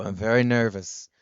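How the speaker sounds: hint of an accented impression; [name]. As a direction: confess with mock-nervous formality. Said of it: "You go".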